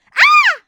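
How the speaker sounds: high loud shriek; short shrill cry of alarm; scream. Acted. Falling through the floor, a short high shriek.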